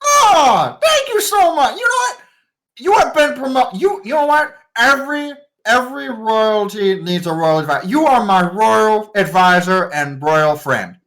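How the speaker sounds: put-on a thrilled young king showering praise; overjoyed boy king. Young king names his new royal advisor, high and thrilled.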